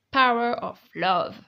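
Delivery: brand name said with a put-on English accent; English accent on the English words. Showing off an English accent to her viewers. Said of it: "Notez mon accent anglais sur dix".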